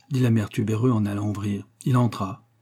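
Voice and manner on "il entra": ordinary delivery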